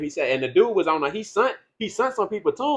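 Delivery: ordinary talk, nothing marked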